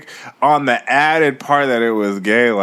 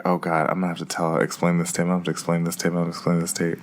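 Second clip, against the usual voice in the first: low, fast, hushed panicked muttering; freaking out; 5 semitones lower, 8 dB quieter, much faster than usual. Retold or imitated. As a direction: mutter the same dread over and over under your breath. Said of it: "I'm still freaking out".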